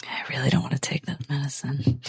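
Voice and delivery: breathy whispered aside, unvoiced and soft; a hushed reluctant whisper. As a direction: whisper it so the doctor won't hear. Player whispers in character.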